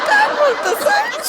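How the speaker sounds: high laughing shriek of protest; laughing, embarrassed maid. Acted. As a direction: break into a giggle while objecting. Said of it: "sorry, sorry".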